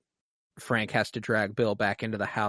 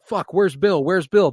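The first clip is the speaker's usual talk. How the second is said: mock panic; 6 semitones higher, 6 dB louder than usual. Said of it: "Frank wakes up and is like".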